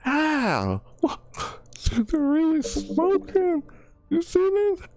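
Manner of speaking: very high, delighted exclamations; thrilled squeal. Real, not acted. Gamer gushing over a skin, very high and thrilled. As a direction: gush at the smoking feathers.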